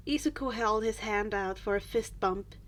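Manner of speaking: plain reading voice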